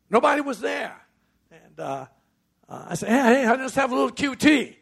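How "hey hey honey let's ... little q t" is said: high, loud, eager come-on; playful call. Retold or imitated. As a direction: sweet-talk her with a big bright call. Husband playfully calling to wife.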